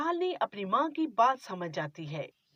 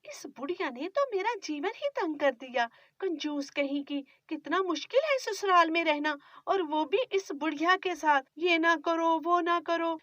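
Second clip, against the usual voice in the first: put-on higher, pleading young wife; young bride; 6 semitones higher than usual. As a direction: defend yourself meekly to your mother-in-law. Bride complains to herself.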